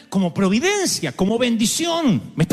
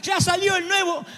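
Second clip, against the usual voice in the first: put-on whiny child; 12 semitones higher than usual. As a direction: wail it like a kid denied a phone. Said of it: "y te dice, Papá".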